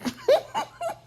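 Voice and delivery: sudden squealing laugh; high burst of laughter. Real, not acted. Laughing at the scene.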